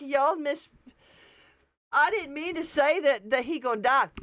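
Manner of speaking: high, mock defensive voice; mocking a girl. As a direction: imitate her backpedaling, high. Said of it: "She's like".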